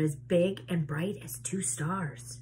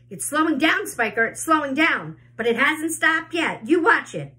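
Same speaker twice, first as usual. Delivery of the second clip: insistent arguing; 8 semitones higher, 6 dB louder than usual. A narrator's reading. Aunt arguing about the peach.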